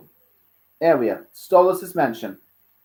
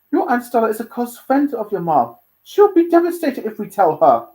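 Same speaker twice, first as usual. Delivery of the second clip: raised, emphatic character voice; concerned uncle Stolas; 9 semitones higher, 8 dB louder than usual. Acted. Stolas worrying how Aunt Stella will take it, concerned. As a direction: lift the voice and press the warning.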